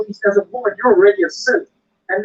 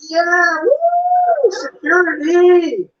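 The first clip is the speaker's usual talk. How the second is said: slow, high, playful security cheer; mock flirty cheer; 9 semitones higher, much slower than usual. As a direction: sing it out like a cheeky stripper cue. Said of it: "you'd be like".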